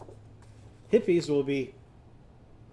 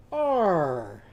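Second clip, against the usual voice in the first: drawn-out "ar" sound; 4 dB louder than usual. Shown as a demonstration. Teacher pulling down on an arm, long falling "ar". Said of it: "pull down and go".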